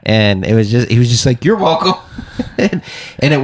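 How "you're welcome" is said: high, earnest you're welcome; imitating the comic's sincere you're welcome; oblivious you're welcome. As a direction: say it sincerely, as if owed thanks. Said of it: "a sincere".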